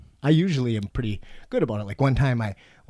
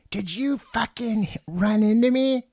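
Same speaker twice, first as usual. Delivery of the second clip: higher, slow, accusing mock outrage; slow, high retold demand from the angry lady; imitating angry lady; 11 semitones higher, 4 dB louder, slower than usual. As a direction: play the furious driver who thinks you hit her. Retold quarrel with other driver.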